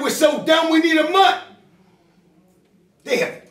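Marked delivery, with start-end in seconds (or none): 0.0-1.4 mocking quote, bigger. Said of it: "He said".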